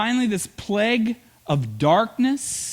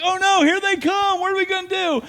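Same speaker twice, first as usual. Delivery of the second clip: much higher, frantic cry of dread; mock panic; 13 semitones higher, faster than usual. Israelites at the Red Sea seeing the army, high mock panic. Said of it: "They're like".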